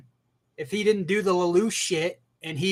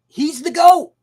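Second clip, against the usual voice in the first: high, loud proud yell; excited shout; 11 semitones higher, 11 dB louder than usual. Real, not acted. Crowning Eren the best with a high, loud yell. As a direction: shout it like a fan at a stadium.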